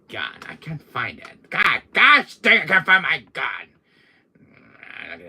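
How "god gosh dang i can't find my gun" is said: loud, high exasperated outburst; frustrated. Acted. Villain unable to find his gun, frustrated. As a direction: lose your temper over it.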